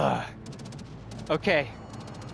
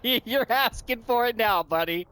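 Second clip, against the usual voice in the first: mock taunt; 7 semitones higher than usual. Taunting enemy player.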